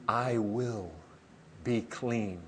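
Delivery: soft, low, with a pause midway; gentle healing words. Acted. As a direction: say it quietly to a leper. Jesus speaking to a leper.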